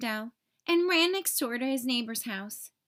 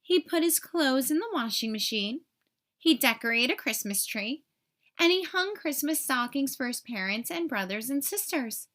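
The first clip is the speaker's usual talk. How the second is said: ordinary delivery, nothing marked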